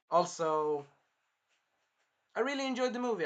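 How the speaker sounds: ordinary talk, nothing marked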